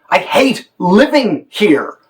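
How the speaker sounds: slow, high, bitter line; bitter resentment. Shown as a demonstration. Director shows an actor the subtext of resentment. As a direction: lean on living with spite. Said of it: "make an offering of subtext".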